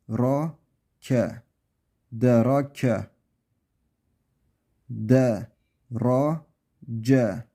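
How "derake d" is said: usual speaking voice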